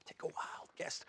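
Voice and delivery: whispered teaser. Real, not acted. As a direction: lean in and whisper the dare.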